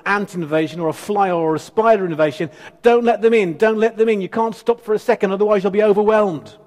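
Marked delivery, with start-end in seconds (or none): 2.8-6.6 louder, higher frantic warning; panicky alarm. Retold or imitated. Preacher mocking the panicked church reading, frantic. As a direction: shout it like pests are swarming in. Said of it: "a mozzie invasion".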